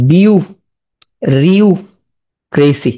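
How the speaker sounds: বিউ, pause, রিউ, pause, ক্রেসি; syllable by syllable. Shown as a demonstration. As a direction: chunk the word for learners. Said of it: "তাহলে এটা পড়ে কি হবে?". (বিউ-রিউ-ক্রেসি থেমে থেমে)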